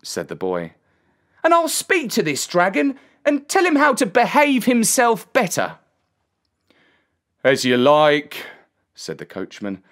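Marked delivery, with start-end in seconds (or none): 1.4-5.8 put-on high, forceful boy's voice; volunteer boldly as a young lad; determined boy. A narrator's reading. Boy offers to confront the dragon, high determined voice. 7.4-8.5 loud, blunt driver's voice; resigned coachman. A narrator's reading. Coachman lets the boy ride along, blunt voice.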